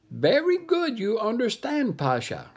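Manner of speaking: very high pleased monkey voice; monkey purring approval, high. Acted. Monkey approving the dog, high and pleased.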